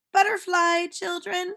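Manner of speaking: put-on a cheerful teacher calling out to children; silly teacher voice. Teacher says goodbye.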